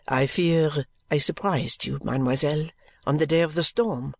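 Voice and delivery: low, measured French servant; mild French maid. Acted. French maid parts from mademoiselle with a curtsy, low and measured. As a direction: speak low and accented, politely taking leave. Narrator voices maid taking leave.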